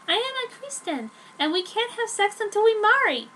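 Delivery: put-on high, bright girlish voice for the heroine; Joan's voice.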